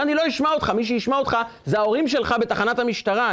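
high, indignant brush-off; acted angry refusal. Driver threatens boy with parents and police, acted anger. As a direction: cut the child off in mock anger.

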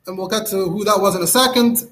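normal talking voice

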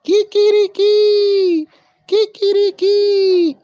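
very high, loud, drawn-out crowing; rooster crow. A narrator's reading. Another voice heard on the road, crowing loudly. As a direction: crow like a rooster, holding the last note. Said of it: "otra voz que decía".

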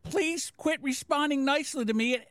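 high-pitched dismissive mimicry; high, put-on mocking read; Eric. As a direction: imitate him in a high, taunting voice. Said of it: "And he goes".

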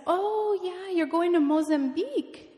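high, drawn-out "oh", animated; Pastor Shangwei. Retold or imitated. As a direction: imitate a funny pastor reacting with interest. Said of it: "he talks like this".

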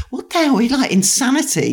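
high, incredulous outburst; mock exasperation. Mocking the family's endless favours, high exasperated cry. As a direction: exclaim it like it's all madness.

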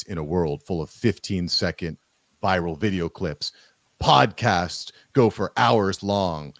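deep, slow movie trailer narrator; in a world full of, trailer style. Shown as a demonstration. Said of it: "I'll put on my movie voice".

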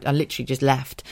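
usual speaking voice

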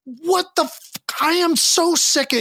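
high, exasperated parent venting; mock frustrated rant; exasperated outburst. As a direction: blurt it out fed up and high. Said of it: "your brain doesn't go to".

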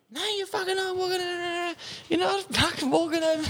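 high, shrill mock girlfriend voice. Mocking his ex-girlfriend as she refuses to let him walk, high mock voice. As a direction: squeal it like the nagging ex.

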